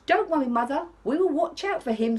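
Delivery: eager little cubs reassuring mum; little wolves. Acted. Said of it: "said the three little wolves".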